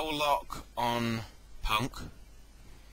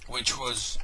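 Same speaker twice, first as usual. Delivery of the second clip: ordinary delivery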